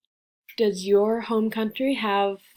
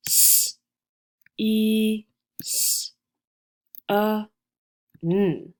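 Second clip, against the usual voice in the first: letter sounds one at a time with pauses; "S, E, S, A, N" spaced out sound by sound; slower than usual. Shown as a demonstration. Teacher counts sounds with students, spaced out. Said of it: "Count the sounds with me".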